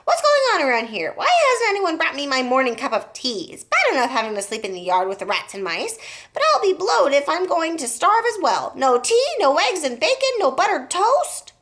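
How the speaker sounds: loud, high, bossy grandma yelling; angry shout. A narrator's reading. Grandma shouting down at the family for breakfast, loud and high. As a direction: bellow down at the family for her tea. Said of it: "glared down ... and shouted".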